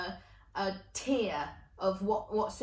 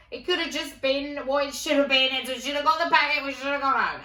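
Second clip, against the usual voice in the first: higher, louder rush of dismay; exasperated inner wail; 5 semitones higher, 7 dB louder than usual. Retold or imitated. Child inwardly despairing over the last box of stuffing, exasperated wail. Acted pleading thought in the shop.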